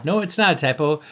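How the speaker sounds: normal talking voice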